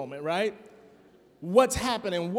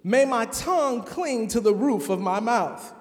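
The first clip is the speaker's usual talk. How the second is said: dramatic scripture reading; 3 semitones higher, 4 dB louder than usual. Acted. Pastor reading Psalm 137 to the congregation, dramatic lament. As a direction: read the psalm like a lament from exile, building to outcry.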